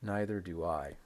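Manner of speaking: "Neither do I" low and falling. Shown as a demonstration. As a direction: drop "Neither do I" down. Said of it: "And negative".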